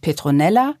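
plain reading voice

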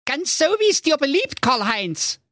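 high, sugary obedient wife; sweetly submissive ogress. Acted. Ogre wife answers husband.

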